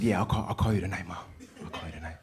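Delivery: fast whispered promise; quick hushed reassurance to mom; hurried whisper. Retold or imitated. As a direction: rush it out under your breath. Son answering mom quickly.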